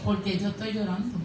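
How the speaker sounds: low, soft aside to oneself; quiet puzzled thought. Retold or imitated. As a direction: voice a puzzled inner question, softly. Retelling her puzzled thought in prayer.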